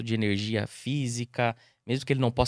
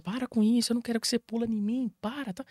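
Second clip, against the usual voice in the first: mock owner scolding; 9 semitones higher than usual. Owner scolds jumping dog.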